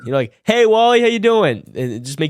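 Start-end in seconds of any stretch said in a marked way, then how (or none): 0.4-1.6 high, loud, happy-to-see-you hello; excited greeting. Shown as a demonstration. Picking up the phone to say hi to someone, excited. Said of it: "be excited".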